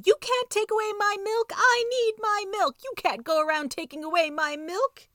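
high-pitched, loud mouse tantrum; furious squeaky screaming fit. A narrator's reading. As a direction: shriek it in outrage, far above your own pitch. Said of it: "he will start screaming at you".

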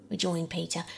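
plain reading voice

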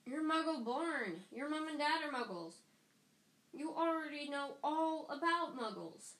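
high, incredulous protest; exasperated disbelief. A narrator's reading. Ron objects to Hermione taking Muggle studies, exasperated.

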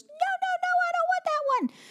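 put-on very high whiny child voice; imitating toddler. Mom mimics her little daughter refusing a book, high whiny child voice. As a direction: squeak it out like a fussy toddler.

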